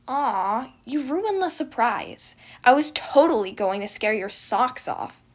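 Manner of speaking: loud, gleeful prankster brother; pouting prankster ruined his own surprise; mischievous twin. Acted. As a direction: tease him loudly like a gremlin twin. Remus teasing brother.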